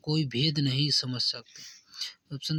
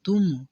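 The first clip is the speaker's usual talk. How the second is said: तुम punched higher and louder; stress on तुम; 4 semitones higher, 5 dB louder, slower than usual. Shown as a demonstration. Teacher explaining sentence stress, तुम strongly stressed. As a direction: hit तुम hard, then pause. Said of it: "तुमको बल देने पर".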